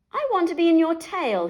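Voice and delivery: fast, loud wolf growl; big bad wolf growling a demand. A narrator's reading. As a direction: growl it at her, quick and menacing. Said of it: "he growled".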